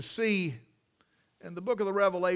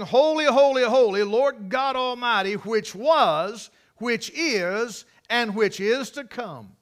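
raised, ringing proclamation; preacher crying out the angels' praise; 3 semitones higher, 6 dB louder, slower than usual. Retold or imitated. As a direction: proclaim it like the heavenly chorus. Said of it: "notice what they're crying".

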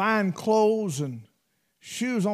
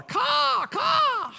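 shrill crow cry, much higher, hollered; crow caw. Retold or imitated. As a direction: caw like a crow on a power line. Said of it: "and he holler".

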